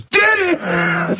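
mock heckler's cry, a little high; rude heckle. Heckler catches another repeated joke, mock rude shout. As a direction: imitate the rude interrupting heckler. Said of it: "like, real rude".

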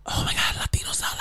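excited breathless whisper. Retold or imitated. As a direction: whisper it like a eureka. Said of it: "And Rachel's like".

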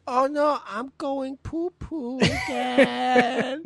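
put-on very high, slow whiny baby talk. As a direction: whimper like a toddler. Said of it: "You're like".

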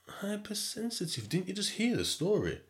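high-pitched acted disbelief; incredulous. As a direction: squeak it out in mock disbelief. Acts out disbelief at being called hypersensitive.

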